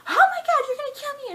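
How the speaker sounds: high, loud mock panic with a laugh; freaking out. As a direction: shriek it in hammed-up terror. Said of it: "keep freaking out like".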